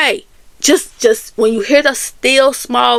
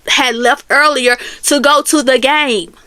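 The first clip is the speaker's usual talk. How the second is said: ordinary talk, nothing marked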